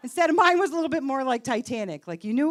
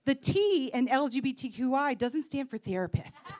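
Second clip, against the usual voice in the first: ordinary talk, nothing marked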